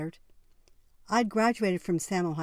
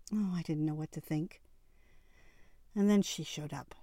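ordinary delivery, nothing marked

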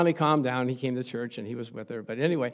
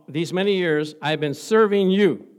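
loud, slow, bitter complaint; resentful son; 4 semitones higher, 11 dB louder, slower than usual. Retold or imitated. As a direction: drag out "serving" with resentment. Son mocking his father.

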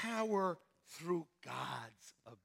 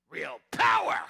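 loud preacher shout; 5 semitones lower, 14 dB louder than usual. Real, not acted. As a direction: erupt into a joyful shout. Preacher erupts after response.

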